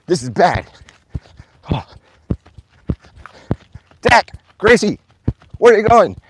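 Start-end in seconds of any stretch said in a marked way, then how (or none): 4.0-6.2 loud, high call across the yard; raised, high-pitched holler; calling after dogs. Real, not acted. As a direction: shout after straying pets.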